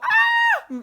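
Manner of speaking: loud high-pitched scream of fright; startled scream. Retold or imitated. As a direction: shriek like you just got scared. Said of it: "we both go".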